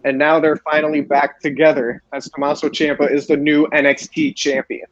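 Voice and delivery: ordinary talk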